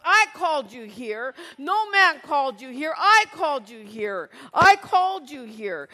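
loud pulpit proclamation; preaching at full force. Acted. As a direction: declare God's judgment with force. Preaching God's call.

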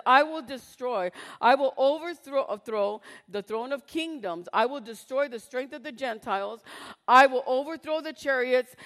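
loud pulpit proclamation; preaching at full force. Acted. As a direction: declare God's judgment with force. Preaching God's words.

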